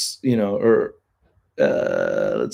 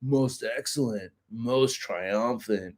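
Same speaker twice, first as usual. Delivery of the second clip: California surfer dude; much slower than usual. Acted. Streamer showing off his California accent, surfer-dude drawl. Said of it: "I got the California".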